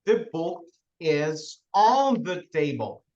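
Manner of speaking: slow, careful The book is on the table; teacher quoting the classic school sentence slowly; slow famous example. Shown as a demonstration. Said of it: "todo mundo lembra da famosa frase".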